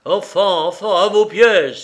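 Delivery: loud, high battle command; captain shouting like a roaring wind; gale-force voice. A narrator's reading. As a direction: bellow the crew to their guns. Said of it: "s'écria le capitaine d'une voix de Mistral".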